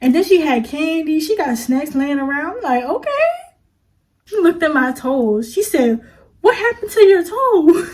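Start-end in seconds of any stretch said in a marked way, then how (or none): 2.8-3.3 high-pitched rising "okay"; acted impressed reaction, pitched high; pleasantly impressed. Pleasantly impressed by candy, snacks. 6.4-7.9 high, loud imitation of alarm; acted-out appalled question; mock shock. Nail tech spots her toes.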